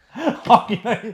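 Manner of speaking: laughing through the words. Real, not acted. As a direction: giggle out the name. Laughing while saying it.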